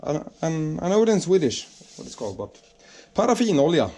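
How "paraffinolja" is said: ordinary talk, nothing marked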